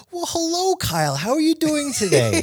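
put-on high, overly proper kid voice; prim little boy. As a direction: play a stiff little businessman. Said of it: "his little brother was always so, like, proper".

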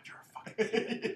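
hushed self-insult. Retold or imitated. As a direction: whisper it to your reflection. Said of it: "and you're like".

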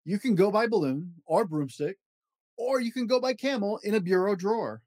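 lively read-aloud of a rhyming picture book; storybook voice, playful and rhythmic; reading to children. Acted. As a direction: bounce through the rhymes for a child at bedtime. Reads Dr. Seuss book aloud.

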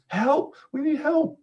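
very high cry for help at no extra volume; contained, high-pitched scream; panicked scream. A narrator's reading. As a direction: call out in high alarm. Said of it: "points at me and screams".

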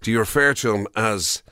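normal talking voice

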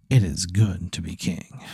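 low, slow and hushed self-talk; saying to yourself. Acted. Waking up and telling himself he's king, low private self-talk. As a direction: murmur it slowly to yourself. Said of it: "you say to yourself".

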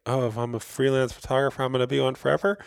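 high-pitched mock fretting; mock worried. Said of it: "don't be scared".

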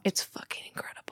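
hushed unison whisper; mock-awed whisper of the two surfers. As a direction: breathe it out in awe. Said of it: "they both say at the same time".

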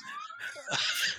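sudden squealing laugh; high burst of laughter. Real, not acted. Laughs at own story.

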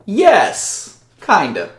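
high backwoods voice, stumbling over questions; a wary yokel pressing the strangers; swamp local, suspicious. Acted.

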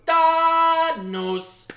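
hammed-up big reveal; drawn-out announcement. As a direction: bellow it and drag out the vowel. Announces name in drawn-out bellow.

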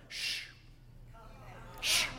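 soft drawn-out "shh"; shushing. Acted. Preacher on back porch to the tornado, shushing. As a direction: hush it with a long shh.

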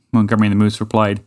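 usual speaking voice

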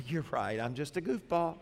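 soft, low, pretend apology; mock meek admission. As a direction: play the humbled driver giving in quietly. Acts unlikely meek reply to insult.

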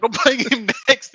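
very high, loud and broken by laughter; imitated excited shout, cracking up; laughing mock declaration. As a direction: repeat a mate's eager challenge through laughter. Repeats the quote, laughing.

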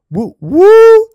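high, loud mock whoop; high loud whooping cheer. Temp cheering on trend.

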